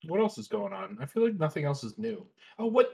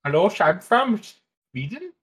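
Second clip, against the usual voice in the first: high, lilting mock-Swedish; Swedish accent; 4 semitones higher, 4 dB louder than usual. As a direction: put on a silly, halting Swedish accent. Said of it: "That's my Swedish".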